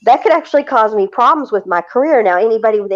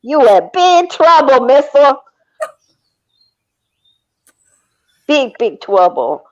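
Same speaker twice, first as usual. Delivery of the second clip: stern scolding voice, slow; mock scolding; 6 semitones higher, 3 dB louder than usual. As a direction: wag a finger at her. Mock scolding the other person.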